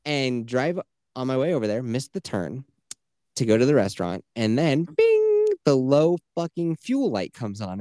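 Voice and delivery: usual speaking voice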